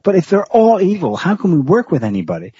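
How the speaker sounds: mildly exasperated quoted question; imitating exasperated objection. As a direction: retell it as a baffled appeal. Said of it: "poor Carlson's left like".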